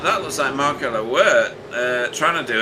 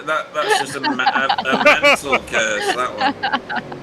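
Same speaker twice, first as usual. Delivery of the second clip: laughing; 10 semitones higher, slower than usual. Real, not acted. Laughing at the curse joke.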